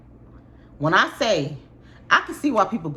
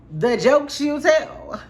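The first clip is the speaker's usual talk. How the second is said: sarcastic amusement; 9 semitones higher, 5 dB louder, much slower than usual. Real, not acted.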